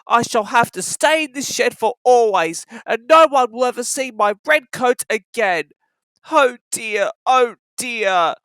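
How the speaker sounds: sadly. Acted. James shut in the shed, lamenting sadly. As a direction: moan like a lonely little engine. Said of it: "he thought sadly".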